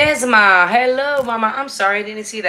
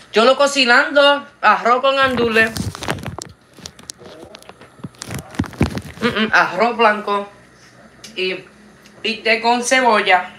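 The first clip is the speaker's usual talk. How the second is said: put-on playful Puerto Rican Spanish accent; 4 semitones higher, 5 dB louder than usual. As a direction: rattle off food names in a Puerto Rican accent. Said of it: "I sound Puerto Rican, right?".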